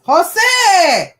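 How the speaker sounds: high shouted name; loud call. Real, not acted. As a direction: yell the name across the house. Calling out to someone.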